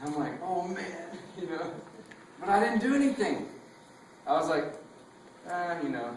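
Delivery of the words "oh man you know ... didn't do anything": ordinary talk, nothing marked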